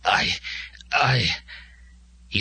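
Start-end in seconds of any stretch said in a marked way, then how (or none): 0.0-1.3 halting, broken-off sputter. A narrator's reading. Wounded Glimung tries to speak to Joe, sputtering. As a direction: struggle to get a single word out. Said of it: "Glimung sputtered".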